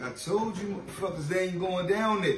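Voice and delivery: retold angry yell. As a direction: throw it back at the guards like he did then. Refusing guards taking him down.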